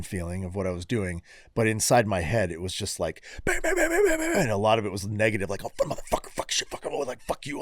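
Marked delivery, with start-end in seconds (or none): 3.4-4.4 high-pitched imitated mental racket; imitated hammering, pitched way up; racing head noise. 5.6-7.6 voiceless, whispered stream of swearing; hushed, frantic mock muttering; inner cursing. Voices negative swearing inside his head.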